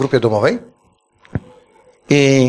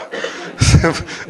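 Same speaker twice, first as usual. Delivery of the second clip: short breathy laugh. Real, not acted. Preacher laughs at the story. (krótki, cichy śmiech)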